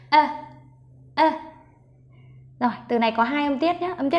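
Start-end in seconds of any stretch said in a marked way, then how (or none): none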